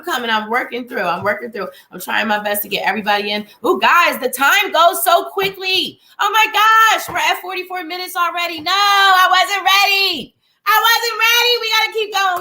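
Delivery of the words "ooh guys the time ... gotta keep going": high, loud mock alarm; mock panic. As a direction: squeal like you're running late. Alarmed at time passing.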